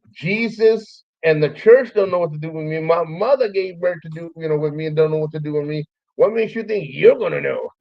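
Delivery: hammed-up incredulous argument; mock exasperation. Acted exasperated comeback to friend.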